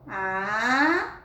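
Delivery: "asa" said slowly and drawn out; sounding out. Shown as a demonstration. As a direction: stretch "asa" for the kids. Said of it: "Com a palavra". ("asa" bem alongado)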